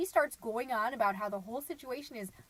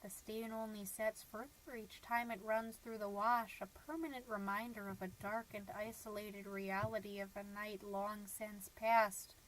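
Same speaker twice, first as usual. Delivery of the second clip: bleak brooding; 2 semitones lower, 5 dB quieter than usual. Acted. Mom dwelling on husband's dinner of cocktail weenies, bleak brooding. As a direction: sink into a soft, monotone dark reverie.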